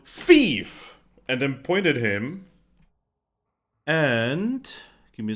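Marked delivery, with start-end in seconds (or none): 0.1-1.0 loud high shout of Thief; yelling thief. Acted. Half-dragon calling out a fleeing thief, loud shout. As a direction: call out to the whole street. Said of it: "she is gonna yell out".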